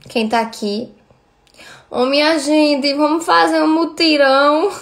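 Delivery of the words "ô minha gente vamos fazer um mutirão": drawn-out meme imitation, pitched up. Streamer rallying viewers with a meme, drawn-out imitation. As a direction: imitate the meme with slow, stretched vowels, rising higher. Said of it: "Eu amo esse meme da [name]".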